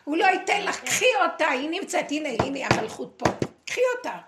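loud, high insistent urging to take it; urgent loud call. Real, not acted. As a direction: push her to grab it, full voice. Urging listener to take kingship.

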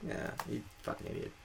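hissed under the breath; a scornful aside, voiceless; whispered insult. Retold or imitated. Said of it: "It's like".

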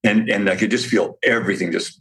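usual speaking voice

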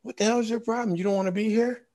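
Jerry West chewing him out; angry coach. Retold or imitated. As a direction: bark at the player like a fed-up coach. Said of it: "Jerry West finally stopped and said".

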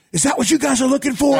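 high, loud furious shout; angry shout at camera. Retold or imitated. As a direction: yell it right into the camera. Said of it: "I'm so mad".

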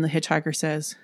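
ordinary delivery

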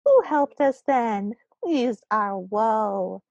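put-on high-pitched, slow toddler recital; toddler's voice. Gnome reciting verse to his crowd, toddler's voice. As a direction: chant it like a tiny child, drawing out the endings. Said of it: "It's a toddler's voice".

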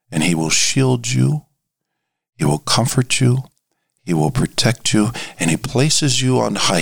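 normal talking voice

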